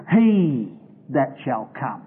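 He drawn out slow and leaned on; "He" slow and weighty, the rest trailing off; stress on He. Shown as a demonstration. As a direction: slowly press the word "He" as the point. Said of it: "He changes the "it" to "him".

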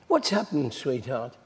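soft, falling, sugary concern; cooing wife. Retold or imitated. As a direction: coax a sulking husband gently. Jezebel cooing to Ahab.

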